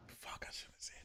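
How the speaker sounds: barely audible whisper to yourself; whispered aside. Real, not acted. Host repeating regret over a slip, whispered. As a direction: whisper it under your breath, regretting the slip.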